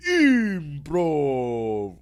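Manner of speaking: "Improv" with Spanish vowels, loud and drawn out. Shown as a demonstration. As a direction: say "Improv" as Spanish speakers do, stretched. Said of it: "that's how you say it".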